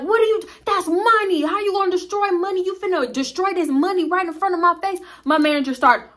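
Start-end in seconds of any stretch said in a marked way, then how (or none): 0.0-5.0 high mock-indignant outrage; outraged customer. Main customer protests destroying his money, high mock outrage. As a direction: pitch it up in disbelief. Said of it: "the main dude is like".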